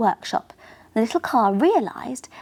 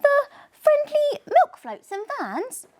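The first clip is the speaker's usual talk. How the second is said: eager. Acted. Curious car's eager question.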